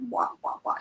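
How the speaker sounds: soft, voiceless mock wah-wah of a muffled adult; Charlie Brown teacher. Teller showing how the father's talk sounded, a soft mock teacher drone. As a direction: lightly imitate the droning teacher from Charlie Brown. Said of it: "the teacher in Charlie Brown".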